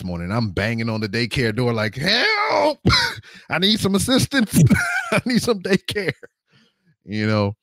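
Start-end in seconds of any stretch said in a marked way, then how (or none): 2.0-6.1 high, laughing plea for help; mock desperate cry. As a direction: beg for help in mock panic. Banging on daycare door.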